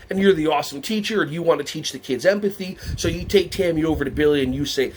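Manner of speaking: ordinary talk, nothing marked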